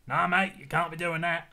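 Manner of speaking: put-on blunt Australian council bloke, Australian accent. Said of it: "what kind of Australian accent that was".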